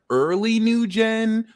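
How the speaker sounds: high, slow early new gen; drawn-out question. Real, not acted. Asking which generation, high drawn-out. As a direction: stretch it out high.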